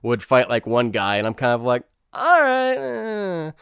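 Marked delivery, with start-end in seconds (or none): 2.1-3.5 high, stretched-out "all right" fading away; skeptical concession. Acted. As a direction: grudgingly let it slide, way up high. Skeptical reaction to fight scenes.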